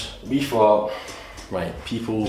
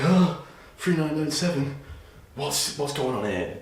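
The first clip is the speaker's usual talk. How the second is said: higher, puzzled what-is-this voice; imagined viewer shocked by the price, higher; mock incredulous viewer; 7 semitones higher than usual. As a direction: act the doubtful listener balking at the price. Voicing the skeptical viewer.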